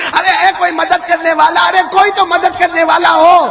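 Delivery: high, loud plea for help; stranded man shouting for help; calling for help. Acted. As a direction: cry out to anyone who might hear. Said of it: "زور زور سے کہنے لگے".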